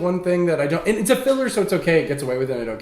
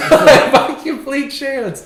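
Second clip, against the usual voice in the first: laughing; 8 semitones higher, 6 dB louder, much slower than usual. Real, not acted. Laughing at random win.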